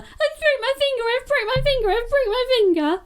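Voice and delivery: high-pitched whining wail, hammed up; exaggerated hysterical repetition; mock panic. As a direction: mimic someone squealing over an injury. Co-host copies the mocked panic.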